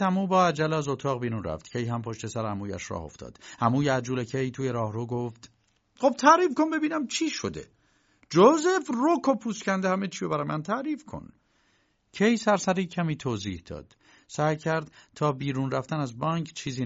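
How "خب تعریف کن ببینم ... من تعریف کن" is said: high, pushy demand; the uncle. Acted. Uncle pressing Josef for the whole story, high and pushy. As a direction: insist he tell all.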